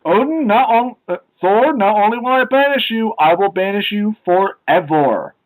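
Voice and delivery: mock Odin decree. Odin banishing Thor forever, mock grand decree. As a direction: declaim it like an angry god, hammed-up.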